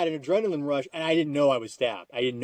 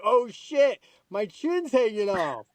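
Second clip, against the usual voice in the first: shocked; 7 semitones higher, much slower than usual. Retold or imitated. Discovering chin hanging off.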